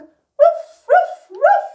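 high, loud playful woofs; sung puppy woofs; dog bark. Shown as a demonstration. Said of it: "Bark like a dog".

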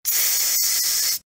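つ as a long, soft voiceless hiss with no vowel; draught-like hiss. Shown as a demonstration. Teacher isolating the tsu consonant, long soft hiss. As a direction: stretch the "ts" of つ like wind through a gap. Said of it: "隙間風のような音が少し出ます".

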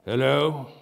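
a put-on grumpy snap hiding his glee; feigned growl. A narrator's reading. Scrooge pretending to be cross with late Bob. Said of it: "growled Scrooge in his accustomed voice, or as near as he could feign it".

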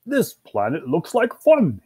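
slow, high, swooping delight; thrilled. Acted. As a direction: savour it with mounting excitement. Thrilled dog greeting a new planet.

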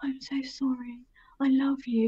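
whispered, pitiful mock plea; pathetic ex. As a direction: mimic a whimpering ex begging softly. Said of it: "weird, pathetic little face".

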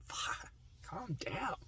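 high unvoiced whispered aside. Real, not acted. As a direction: hush it to yourself.

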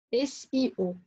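ordinary talk, nothing marked

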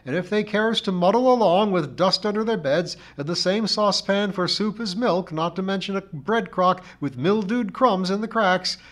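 high, loud scolding char lady; tirade in full spate. A narrator's reading. Missus Pringle overbears the narrator about slatternly ways, in full spate. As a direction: roll on unstoppably with your grievance. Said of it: "Missus Pringle in full spate".

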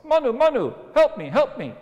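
very high, loud little squeak; squeaky little fish voice. Acted. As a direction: cry out like a tiny fish. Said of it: "a little squeaky voice".

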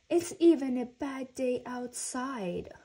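put-out grumbling. A narrator's reading. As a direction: grumble it like a sulky child. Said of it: "grumbled Franklin".

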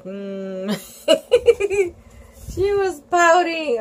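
ordinary delivery

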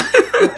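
sudden high laugh; real laugh breaking out; high burst of laughter. Real, not acted. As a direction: crack up at the memory.

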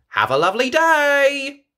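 high, loud cheery farewell; Miss Rabbit voice. A narrator's reading. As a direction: beam it out to the customers. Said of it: "she says".

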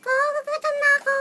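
high, loud squeaky chicken voice; chicken's voice. Retold or imitated. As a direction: voice the hungry chicken.

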